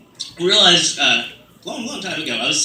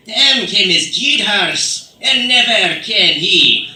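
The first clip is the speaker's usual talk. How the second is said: put-on loud, drawn-out Old English in a British accent; slow, ringing British-accented recital; British-style accent with inflection; 4 semitones higher, 7 dB louder, much slower than usual. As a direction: declaim it in an exaggerated British accent. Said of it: "you give it a little inflection".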